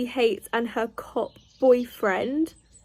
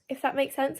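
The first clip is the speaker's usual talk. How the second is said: ordinary talk, nothing marked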